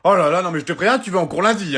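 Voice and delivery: loud parental telling-off; exasperated, loud warning; angry scolding. Acted. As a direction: scold him at full voice. Parent scolds child loudly.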